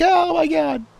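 high-pitched comic panicked cry; mock panic. Imitating an Enderman teleporting all over, in mock panic. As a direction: imitate a freaked-out creature, high and frantic. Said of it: "when an Enderman's just, like, teleporting".

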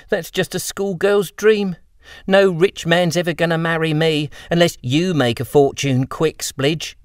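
put-on high girlish reply with a grin; cheeky girl. Lucy teasing Splidge about marrying rich, high cheeky girl voice. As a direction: tease him back playfully.

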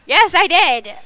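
loud, high comeback; defiant retort. Real, not acted. Player owning up to the threat, loudly defiant. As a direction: fire it back high and loud. Playful quarrel with listener.

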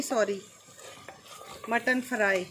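ordinary talk, nothing marked

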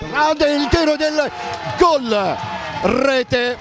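excited goal call; rising voice for the goal; breathless italian goal commentary. Real, not acted. (telecronaca concitata del gol)

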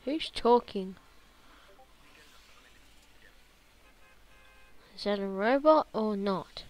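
loud, calling over voice chat. Real, not acted. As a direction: call out to strangers. Calling out to other players.

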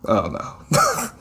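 usual speaking voice

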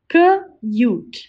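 K-ute split into onset and long vowel; segmented. Shown as a demonstration. Teacher sounding out K-ute for the class. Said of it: "Repeat after me".

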